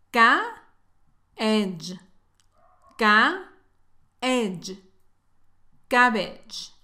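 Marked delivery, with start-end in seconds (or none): 0.1-4.8 teacher sounding out "Ca", "edge" separately; syllable breakdown. Shown as a demonstration. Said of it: "tienen pronunciación diferente. Escucha".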